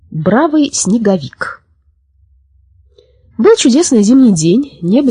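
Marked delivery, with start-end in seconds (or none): none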